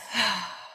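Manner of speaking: long breathy exhale through the mouth; releasing sigh. Shown as a demonstration. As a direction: sigh the held breath out, demonstrating for the class. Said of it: "then release out through the mouth".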